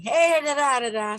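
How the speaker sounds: high, loud chatty babble; mimicking husband. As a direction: mimic him barging in with chatter. Imitating husband starting to talk.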